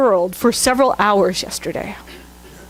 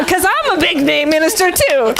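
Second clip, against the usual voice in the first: higher-pitched mock self-importance; jokey swagger, pitched up; mock boasting; 6 semitones higher than usual. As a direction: brag playfully for laughs. Jokes about being a big name.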